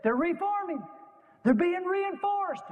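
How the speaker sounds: put-on high, slow boy lookout warning; a hint of a frightened boy calling out; young lookout's alarm. Lookout boy warns Chamberlain of the enemy, high alarmed call. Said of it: "The twelve-year-old lookout said".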